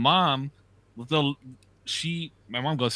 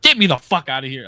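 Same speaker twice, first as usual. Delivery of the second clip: retold anger; 3 semitones higher, 8 dB louder than usual. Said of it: "I was fucking pissed".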